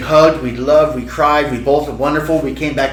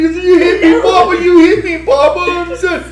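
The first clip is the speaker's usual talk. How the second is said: put-on very high toddler wailing; mock tearful child's whine; crying little girl; 3 dB louder than usual. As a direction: imitate a child sobbing in protest. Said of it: "And she's crying".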